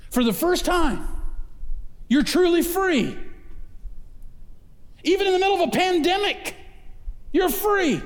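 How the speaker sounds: high, ringing proclamation; preaching at full force. Acted. Pastor proclaiming freedom even in a pandemic, high ringing preaching. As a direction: declare it like a triumph to the room.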